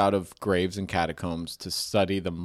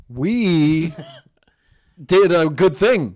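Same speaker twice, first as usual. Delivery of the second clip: mock proud; 5 semitones higher, 9 dB louder, much slower than usual.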